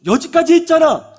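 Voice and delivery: loud, high snapping mother; angry mother. Acted. Mother snaps at her child, loud and high. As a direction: snap back at the kid.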